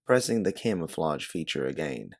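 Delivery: ordinary delivery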